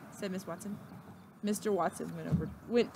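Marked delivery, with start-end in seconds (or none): none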